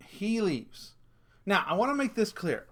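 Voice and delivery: normal talking voice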